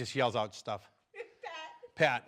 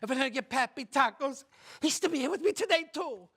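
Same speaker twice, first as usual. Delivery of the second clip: put-on high-pitched Mexican-accented lament; hammed-up Mexican widow; 9 semitones higher than usual. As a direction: wail like a grieving Mexican wife, climbing higher. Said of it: "The Mexican wife says".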